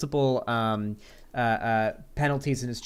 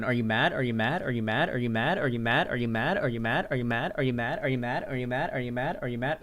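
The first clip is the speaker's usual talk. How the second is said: repetitive rising question, run together without a breath between; Destiny mock-taunt. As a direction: chant it over and over like a stuck record. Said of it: "saying into a microphone".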